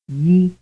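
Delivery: one syllable; glide plus vowel. Shown as a demonstration. Teacher quizzing students. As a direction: slide into the vowel. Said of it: "The answer is B".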